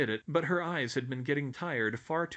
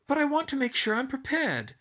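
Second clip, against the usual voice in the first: put-on high, fretful young girl; anxious schoolgirl voice; 14 semitones higher, 5 dB louder than usual. A narrator's reading. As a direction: worry out loud about exams.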